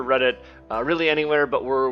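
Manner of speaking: ordinary talk, nothing marked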